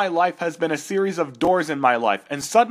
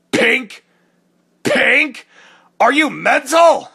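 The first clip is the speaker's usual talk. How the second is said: outraged disbelief; 7 semitones higher, 6 dB louder, slower than usual. Acted. Outraged at pink gloves.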